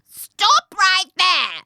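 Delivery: high, slow, loud witchy shriek; raspy, angry crone. A narrator's reading. Old woman warning off traveller, raspy angry shout. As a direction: rasp it out like a furious witch. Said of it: "it's a raspy, angry voice".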